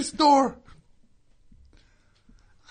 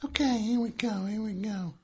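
ordinary talk

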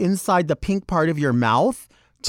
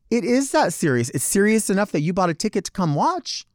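ordinary delivery

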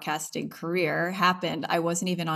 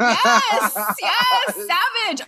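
ecstatic; 6 dB louder, much slower than usual. Real, not acted. Ecstatic reaction.

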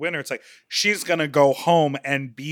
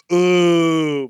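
mock ooh; 8 dB louder than usual. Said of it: "everyone was like".

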